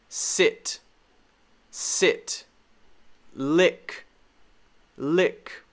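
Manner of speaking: "sit" and "lick" with short "ih"; careful, separate "sit" and "lick"; short I demo. Shown as a demonstration. Teacher reviewing short I words, careful "sit, lick". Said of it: "We also have the I sound".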